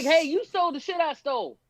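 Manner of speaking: high, put-on mock accusation; lightly voiced mock accuser. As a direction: parody a petty comic complaining. Mocking the comics' dispute.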